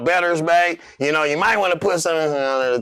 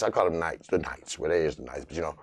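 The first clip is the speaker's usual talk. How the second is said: much lower, softer muttering; low quiet aside; mumbled aside; 12 semitones lower, 9 dB quieter, faster than usual. Real, not acted. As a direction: mutter to yourself. Muttered aside about the name.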